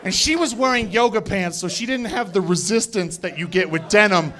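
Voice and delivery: ordinary talk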